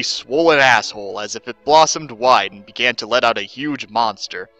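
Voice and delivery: plain reading voice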